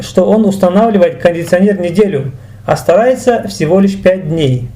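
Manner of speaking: ordinary delivery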